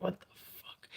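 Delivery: breathless whispered disbelief; stunned whisper. Retold or imitated. Hearing her ex's joke, shocked whispered disbelief. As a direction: hiss it out in shock under your breath.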